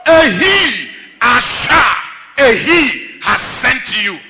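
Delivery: loud, rising preacher's cry to the congregation; preaching at full force. Acted. Preacher proclaiming God's name to the church, at full force. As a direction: preach it out, driving every line home.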